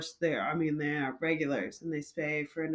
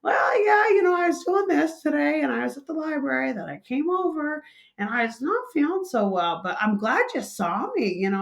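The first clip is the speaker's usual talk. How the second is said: put-on higher, louder, chatty elderly lady voice; chatty old lady; 4 semitones higher, 3 dB louder than usual. Barista imitating the chatty regular recounting her fainting, higher voice. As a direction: play the talkative regular rambling on. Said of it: "back to her old self".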